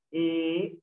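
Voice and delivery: "E" held long on its own; drawn-out "E", then stop; syllable by syllable. Shown as a demonstration. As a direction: sound out "E" as a separate syllable. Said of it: "Se separa así".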